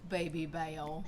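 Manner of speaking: put-on lisping kid Baby Bear, Bale for Bear; says w for r. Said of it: "say your R's and stuff wrong".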